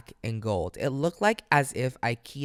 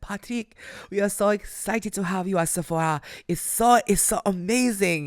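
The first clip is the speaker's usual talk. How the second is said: put-on higher, excited French-accented gushing; 8 semitones higher, 4 dB louder than usual. Sephora's CEO welcoming a new brand, in a French accent. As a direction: imitate an effusive French executive. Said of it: "part of my French accent".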